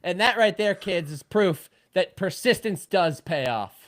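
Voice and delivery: high, loud, slow victorious gloat; gloating triumph. Real, not acted. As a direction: savor the win like a proud mentor. Gloating over Verstappen win.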